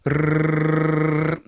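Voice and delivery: trilled "Rrrr"; long tongue roll; tongue-trilled "Rrrr" held. Shown as a demonstration. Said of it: "roll your R's".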